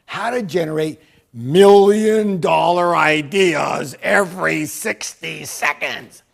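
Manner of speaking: loud, booming title reveal; grand announcement. Real, not acted. Presenter announces the fourth course part, loud and drawn out. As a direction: belt it out slowly like a headline.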